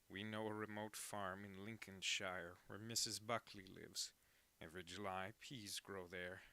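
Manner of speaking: lightly put-on, measured orator voice, falling away at phrase ends; a half-hearted attempt at a grand narrator, slowing at the close; bad Orson Welles. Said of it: "that was a really bad Orson Welles".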